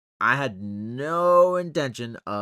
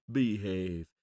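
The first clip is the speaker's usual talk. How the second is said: silly put-on voice; 3 semitones lower than usual. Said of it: "I don't know why I'm talking like that".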